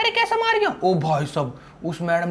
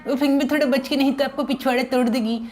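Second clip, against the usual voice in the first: childish cartoon-boy voice, a little higher; Sinchan voice; 6 semitones higher than usual. Sinchan teasing his buddy Franky, in a childish cartoon voice. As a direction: play the cheeky cartoon kid. Sinchan warns about police.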